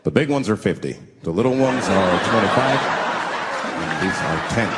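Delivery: low deadpan chief voice. Retold or imitated. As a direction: voice a grave chief calmly pricing weed. Chief selling weed, voiced.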